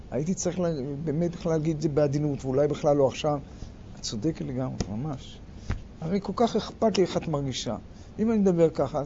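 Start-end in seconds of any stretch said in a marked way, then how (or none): 4.0-7.8 quiet, lower giving in; soft concession. Acted. Husband conceding to his upset wife, soft and low. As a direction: gently agree to calm her down.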